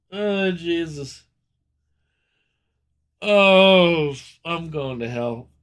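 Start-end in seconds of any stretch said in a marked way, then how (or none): none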